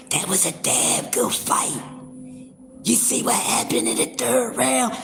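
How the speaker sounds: soft, breathless whisper of a worn-out voice; whispered recap with a shot voice; hoarse whisper. Retold or imitated. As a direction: talk as if the voice is gone after yelling. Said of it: "I wanna be sounding like Whispers".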